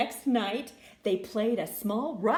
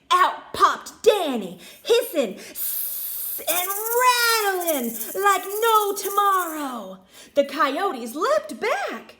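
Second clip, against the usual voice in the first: much louder, higher action telling; dramatic burst; 7 semitones higher, 8 dB louder than usual. A narrator's reading. Narrator at Danny's big entrance, loud excited telling.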